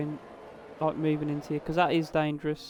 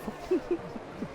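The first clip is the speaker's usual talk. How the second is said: muffled laugh; 13 semitones higher, 5 dB quieter than usual. Real, not acted. Laughs at ice cube mishap.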